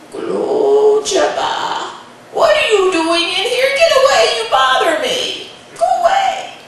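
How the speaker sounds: put-on high, loud, cackling old woman shooing; grumpy grandmother. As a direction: shoo the pest away, cranky granny. Grandmother shoos the boy.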